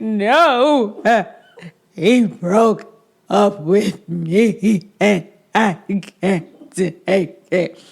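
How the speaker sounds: slow, loud, high mock sobbing plea; hammed-up heartbroken lament; melodramatic. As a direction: play it like a Lifetime movie breakdown. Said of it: "most melodramatic Lifetime movie channel".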